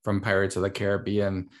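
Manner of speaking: ordinary talk, nothing marked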